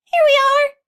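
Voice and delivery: very high, loud, out-of-breath hens; high breathless hen voices; puffing hens. Acted. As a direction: pant it after running up. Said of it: "puffed the hens".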